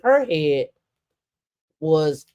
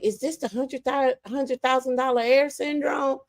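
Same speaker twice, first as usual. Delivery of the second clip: high, softer sarcastic quip; high mock question; 9 semitones higher, 4 dB quieter than usual. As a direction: raise the pitch and needle them. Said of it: "I was like".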